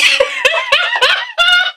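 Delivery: long high-pitched cackle; helpless laughter. Real, not acted. Vlogger cracking up at the enchilada line, helpless laughter.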